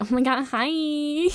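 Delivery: high, loud gushing hello; over-the-top greeting. Pretend. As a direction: greet him back with exaggerated delight. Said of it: "I'm just gonna be like".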